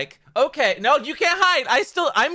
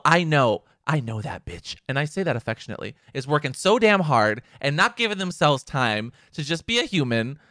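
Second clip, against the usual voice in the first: ordinary talk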